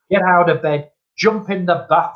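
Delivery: ordinary talk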